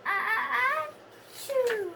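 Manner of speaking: high gasping ah ah then a sneeze; sneeze build-up. Acted. Gerald builds up to a sneeze mid-sentence. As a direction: gasp twice, then sneeze.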